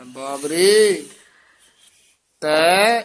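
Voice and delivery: ਬਾਵਰੇ set apart by a clear pause; pause after the word. Shown as a demonstration. Teacher demonstrating where to pause in the verse. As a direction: stop after ਬਾਵਰੇ, then a short break after ਤੈ. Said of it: "ਬਾਵਰੇ ਪਦ ਤੇ ਵਿਸਰਾਮ ਲਾਉਣਾ ਹੈ".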